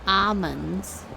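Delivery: almonds said "AH-munds", silent L. Shown as a demonstration. Streamer puzzled by the variant, silent L. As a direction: drop the L in almonds. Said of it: "I've never heard anybody say".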